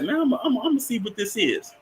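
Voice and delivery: high, hushed, eager curiosity. Retold or imitated. Mustafa the scientist, high and hushed. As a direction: play the mad scientist peeking in.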